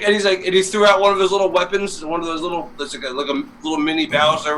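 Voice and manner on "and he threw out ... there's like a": ordinary talk, nothing marked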